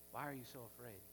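soft, low and tender reassurance; gentle, kneeling Jesus. Retold or imitated. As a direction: kneel and speak eye to eye. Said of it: "in the opposite way".

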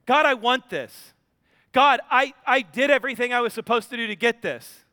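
put-on whiny child praying. Believer praying like a demanding child, loud whine. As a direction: whine at God like a spoiled kid. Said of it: "Are we the kid that says".

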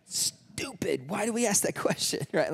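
high, squeezed, laughing self-scolding; mock self-reproach. Disciples regretting their question, laughing mock self-reproach. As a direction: scold yourself under a laugh, pitched high.

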